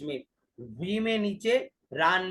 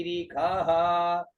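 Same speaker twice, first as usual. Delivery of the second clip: ordinary delivery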